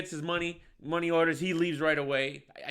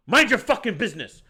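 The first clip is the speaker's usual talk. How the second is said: higher, louder mock snap; retold angry retort; 6 semitones higher, 6 dB louder than usual. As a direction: imitate a tough guy telling someone off. Acted tough guy snapping back.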